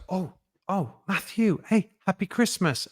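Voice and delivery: a hint of a delighted shop assistant spotting him; mild imitation of a surprised, friendly cashier; checkout woman's greeting. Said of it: "and the woman goes".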